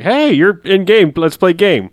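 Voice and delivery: loud, slow call across to a friend; mock shout summoning a friend to play. Friend in game being called to play, loud slow call. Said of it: "and I was like".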